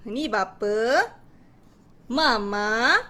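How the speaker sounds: slow, drawn-out words with swooping intonation; clear expressive storytelling. Shown as a demonstration. Teacher telling children about the vowel family, expressive storytelling. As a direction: pause after each phrase, lifting the endings. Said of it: "kuat, jelas dan penuh intonasi".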